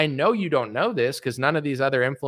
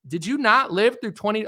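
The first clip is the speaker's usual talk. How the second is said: ordinary delivery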